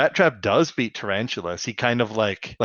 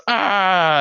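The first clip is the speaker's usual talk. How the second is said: mock cry; 8 semitones higher, 5 dB louder than usual. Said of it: "he goes".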